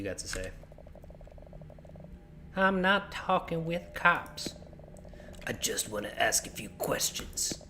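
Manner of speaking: gruff cop. Pretend. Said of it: "the gruff cop voice".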